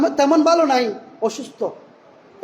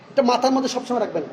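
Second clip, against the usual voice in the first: ordinary delivery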